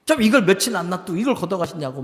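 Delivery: high, loud griping at God; acted tantrum. Preacher acts Jonah complaining to God over the lost plant, petulant anger. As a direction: throw an indignant mock tantrum. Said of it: "성질을 내는 거예요".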